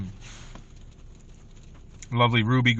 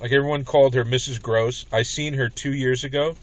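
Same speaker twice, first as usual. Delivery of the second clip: ordinary talk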